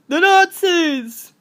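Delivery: loud, slow, drawn-out silly shout; class clown voice. Acted. Class clown speaking up in history class, silly loud voice. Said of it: "I'm sorry for that voice".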